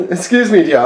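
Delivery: high, prim posh-English voice, laughing through it; a high, laughing posh English "excuse me, dear"; posh English accent. Pretend. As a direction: put on a snooty posh English lady. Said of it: "do your accent like that".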